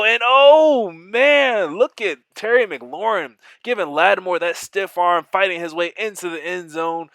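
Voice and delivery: big surge of excitement, pitch leaping up; excited. Real, not acted. Commentator hyping his own touchdown, excited shout. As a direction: shout it out as the receiver breaks free.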